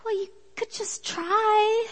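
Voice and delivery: high, hesitant mock meek voice; lightly voiced shy suggestion; a hint of a meek girl nudging; timid younger self.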